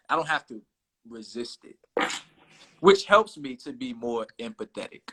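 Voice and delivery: ordinary talk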